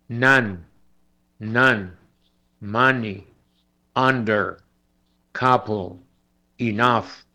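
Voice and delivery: sun, some, money with a very short a; short a demo. Shown as a demonstration. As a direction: clip the vowel short in each word. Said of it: "Esta es una A muy breve, muy corta".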